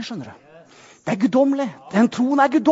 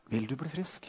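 much softer, lower voice of Jesus; gentle question; 9 semitones lower, 19 dB quieter than usual. Retold or imitated. Jesus asking the lame man at the pool, soft and low. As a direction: ask it softly, almost under the breath. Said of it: "Og så sier Jesus".

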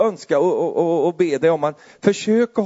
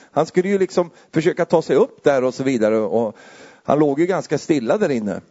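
ordinary talk

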